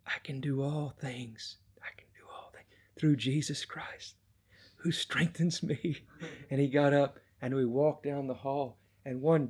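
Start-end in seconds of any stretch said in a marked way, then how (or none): 0.1-6.0 imitating a frail son reciting scripture; hushed, effortful, gaining a little volume by the end; weak whisper. Sick son rising to walk, whispering scripture. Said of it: "just barely able to whisper".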